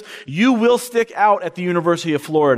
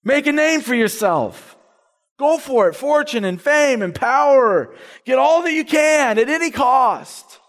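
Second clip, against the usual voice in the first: higher, slower mock shout of the world; mock world screaming; 7 semitones higher than usual. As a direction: voice the world's demand, slow and raised. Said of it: "the world is always screaming".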